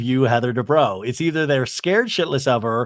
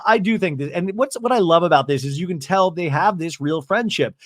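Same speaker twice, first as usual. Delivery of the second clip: ordinary talk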